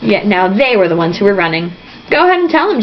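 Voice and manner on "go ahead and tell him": higher, defiant call; mild shout. A narrator's reading. Jack calling after the fleeing Delanceys, defiant. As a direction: throw it after them defiantly. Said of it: "Jack shouted".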